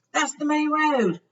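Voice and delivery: high, slow George voice; George pointing out. A narrator's reading. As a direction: twin peering down.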